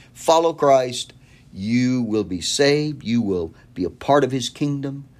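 slow, weighty promise; slow emphasis. Acted. Preacher promising salvation to the listener, slow and weighty. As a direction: lay each word down slowly. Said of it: "slow emphatic promise".

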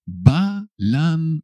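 high, measured teaching take of Balancear; syllable by syllable. Shown as a demonstration. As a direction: sound out Balancear piece by piece. Teacher sounds out the verb.

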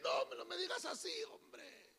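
fast, soft, fading to a whisper; hurried embarrassed whisper; hurried hushed plea. Retold or imitated. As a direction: rush the plea, dying to a whisper. Embarrassed reply.

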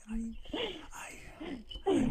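ordinary talk, nothing marked

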